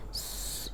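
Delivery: a whispered sss, the whistle of س; whistling hiss. Shown as a demonstration. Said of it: "اس میں سیٹی کی sound آئے گی".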